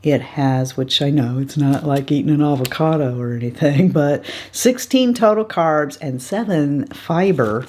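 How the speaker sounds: ordinary talk, nothing marked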